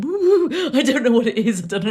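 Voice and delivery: high-pitched playful alarm in the head; mock panic. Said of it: "there was panic".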